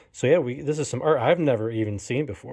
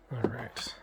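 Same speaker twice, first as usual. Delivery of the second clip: soft whispered aside; 9 dB quieter than usual. Real, not acted. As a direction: breathe it out under your breath. Quiet aside while reboxing.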